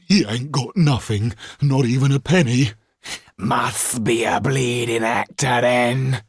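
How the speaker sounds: a thug's loud disgusted scoff; grumbling thug. Acted. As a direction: complain the victim is broke. Thieves grumble over empty pockets.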